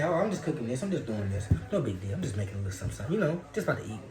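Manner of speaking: no big deal, easy and low; nonchalant. Pretend. Pretending to nosy people he is only cooking, casual and low. Acting unbothered while cooking.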